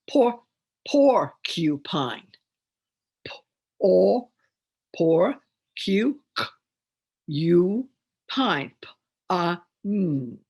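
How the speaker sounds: PORCUPINE split into "por", "cu", "pine"; each sound of PORCUPINE isolated, with pauses; syllable by syllable. Shown as a demonstration. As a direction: loudly sound out "porcupine" piece by piece. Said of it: "do a demonstration of higher level reading".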